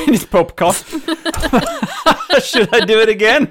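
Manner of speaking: repeating Popecast through laughter; laughing. Real, not acted. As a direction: giggle your way through the line. Co-host repeats the pun, laughing.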